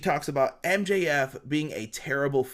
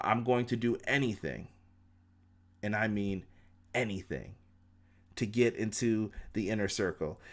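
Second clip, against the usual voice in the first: intense low vow; 4 semitones lower, 6 dB quieter than usual. Retold or imitated. MJF's intense vow to Jericho.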